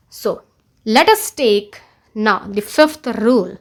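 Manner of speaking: ordinary talk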